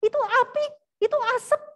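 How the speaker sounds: high, loud, emphatic reply; insistent pointing-out. Retold or imitated. Ship's crewman explaining the signal to the castaway, insistent. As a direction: press the obvious fact on him.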